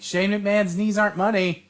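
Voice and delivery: high-pitched crowd heckle; mock heckler yell. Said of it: "yelled something totally".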